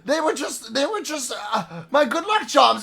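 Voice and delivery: high, flustered excuses; cornered gambler. Acted. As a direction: stammer defensively as the cornered gambler.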